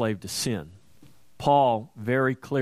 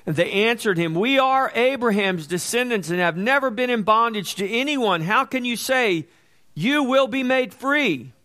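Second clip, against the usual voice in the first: raised, louder preaching voice, pushing each phrase; fervent preaching; 6 semitones higher, 6 dB louder than usual. Acted. Preacher urging believers, in full-force sermon voice. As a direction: preach it out to the congregation with fire.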